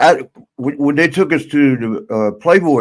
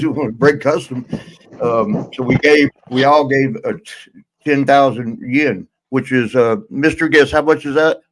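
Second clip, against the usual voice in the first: normal talking voice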